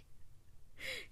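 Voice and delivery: airy giggle; a quick high giggle; high breathless laugh. Real, not acted. Laughs after the child voice.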